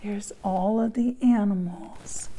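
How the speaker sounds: soft whisper to the listener; whispered aside. Real, not acted. Reader pointing out the picture to the child, hushed whisper. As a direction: lean in and whisper it.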